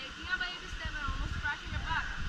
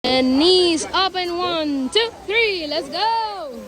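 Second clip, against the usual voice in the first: loud, slow count to jump; shouted countdown; 16 dB louder, much slower than usual. Real, not acted. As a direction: call the count across the river.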